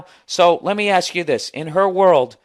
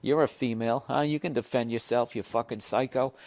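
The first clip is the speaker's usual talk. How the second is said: much softer, teasing the dog; talking to the dog; 3 semitones lower, 7 dB quieter than usual. Real, not acted. Man teases his dog Cleo, soft and playful. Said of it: "My dog's looking at me".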